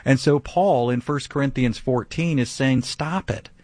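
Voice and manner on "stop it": ordinary delivery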